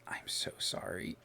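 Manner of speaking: weak, slow whisper; whispered apology. Acted. Injured brother apologizing as he grabs your hand, slow faint whisper. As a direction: barely get the apology out, all breath.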